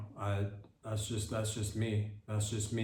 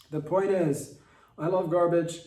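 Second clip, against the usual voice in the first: proud declaration; 6 semitones higher, 6 dB louder than usual. Acted. Punchline declaration.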